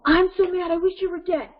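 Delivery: high, loud, mad outburst, acted; intensity. Shown as a demonstration. Child's words to parent, acted intense anger. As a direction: blurt it like a furious child, pitch up. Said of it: "using this intensity".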